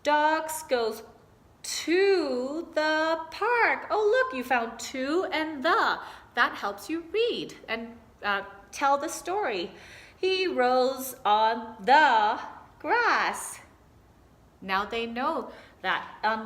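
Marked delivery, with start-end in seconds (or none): 0.0-3.9 very slow, word by word, louder; slow pointed reading. Shown as a demonstration. Teacher modelling reading while pointing, very slow and loud. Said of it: "you might say something like". 10.2-13.6 very slow, word by word, louder; slow pointed reading. Shown as a demonstration. Teacher modelling reading while pointing, very slow and loud. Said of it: "you might say something like".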